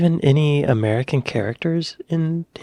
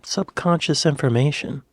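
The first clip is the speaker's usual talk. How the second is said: usual speaking voice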